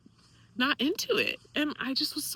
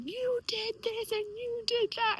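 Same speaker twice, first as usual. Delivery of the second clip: mock scream; 12 semitones higher, slower than usual. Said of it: "screaming at me".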